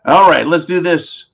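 usual speaking voice

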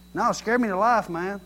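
ordinary delivery

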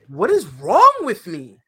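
exasperated. Real, not acted. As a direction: plead at yourself, slowly. Slow exasperation.

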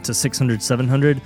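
ordinary talk

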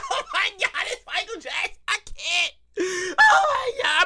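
weak, tearful fan wail; acted swooning hysteria; freaking out. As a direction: gasp it out like an overwhelmed fan. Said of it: "And you sitting there freaking out".

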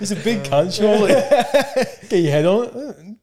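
high, laughing protest. Real, not acted. Quiz player laughing while defending his wrong guess. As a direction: giggle through the excuse.

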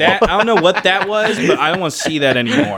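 loud, high, alarmed voice; alarmed shout. Retold or imitated. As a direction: yell it like you saw something awful. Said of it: "Just enough for them to be like".

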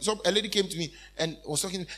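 usual speaking voice

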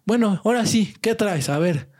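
high, challenging schoolyard bully. Retold or imitated. Bully confronting the boy at his desk, lightly voiced. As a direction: square up to him. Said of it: "se pone enfrente de mi".

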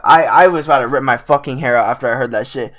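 ordinary talk

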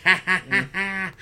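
high mocking laugh imitation; Seth Rollins laugh. Host imitating Seth Rollins laughing at Finn, high and mocking. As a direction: cackle up high at a fallen rival. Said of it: "doing his little Seth Rollins laugh".

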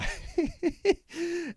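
a high burst of laughter; high laughing burst at own joke. Real, not acted. Laughs at own silly story.

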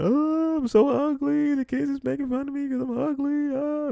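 very high, whiny mock sob voice; exaggerated high falsetto whimper mocking a crying boy; mock crying kid. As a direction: squeak it out like a bawling little kid. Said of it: "while I'm crying".

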